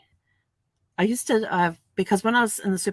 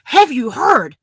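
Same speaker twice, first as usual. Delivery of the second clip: louder, higher breathless gossip; mock gossip; 6 semitones higher, 11 dB louder, much slower than usual. She teases husband with tabloid gossip, loud and eager. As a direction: grab him and spill the news. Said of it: "grab Brad and go".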